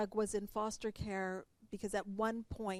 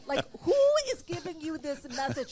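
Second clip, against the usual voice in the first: laughing disbelief; 7 semitones higher, 8 dB louder, faster than usual. Real, not acted. Laughing incredulous question.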